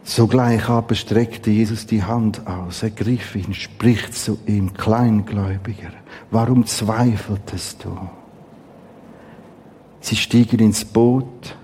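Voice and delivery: ruhig und tiefer vorgelesen; Bibellesung. Acted. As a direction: lies den Text gesammelt und gemessen vor. Liest Matthäus vor, leiser. (feierliche, leise Schriftlesung)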